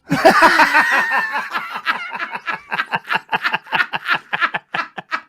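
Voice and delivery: long loud laugh after the punchline; really laughing out loud, hard and long; loud burst of laughter. Real, not acted.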